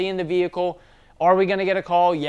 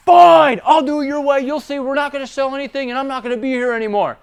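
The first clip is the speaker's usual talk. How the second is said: very loud, much higher angry shout; furious yell; 10 semitones higher, 7 dB louder than usual. Retold or imitated. Employee shouting back at his boss in a yelling match, loud furious shout. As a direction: explode back at the boss in a rage. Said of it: "we're in a yelling match".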